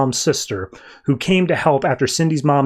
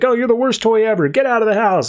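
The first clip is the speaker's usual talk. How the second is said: higher-pitched mock outrage; fake arguing; 7 semitones higher than usual. [name] stages a fight with Harley, fake angry. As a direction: play a staged quarrel lightly. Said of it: "it's clearly fake".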